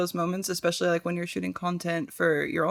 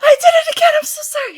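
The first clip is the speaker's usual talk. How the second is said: mock cry; 10 dB louder, much faster than usual.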